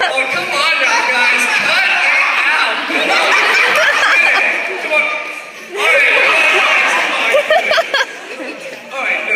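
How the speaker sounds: laughing, loud come on now; laughing protest. Real, not acted. Comic laughing at the heckling crowd, loud. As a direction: crack up while begging them to stop.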